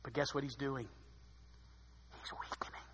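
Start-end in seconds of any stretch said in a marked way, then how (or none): 2.1-2.8 hushed whisper for effect. Acted. Preacher to congregation, whispering Samson's decline. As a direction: breathe it like a secret. Dramatic aside about Samson.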